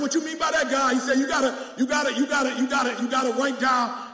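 loud, high shouted preaching; preaching at full force. Acted. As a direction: whip the church up, full voice. Preaching dialogue with God.

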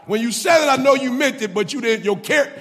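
high, loud preacher's cry; preaching at full force. Acted. Preacher voicing God confronting Peter, impassioned shout. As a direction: build and hammer each line.

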